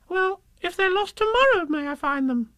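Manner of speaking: very high squeaky plea; hopeful little Roo. Acted. Roo presses Kanga, high squeaky hope. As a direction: keep asking hopefully.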